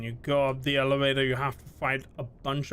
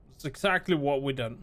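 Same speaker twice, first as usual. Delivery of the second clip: ordinary delivery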